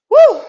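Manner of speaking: high whooping woo to pump up the class; cheering on students mid workout, loud high woo; excited cheer. Real, not acted. A loud, high whoop of joy.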